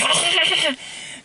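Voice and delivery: a short high burst of laughter. Real, not acted. Laughing at Gizmo scene.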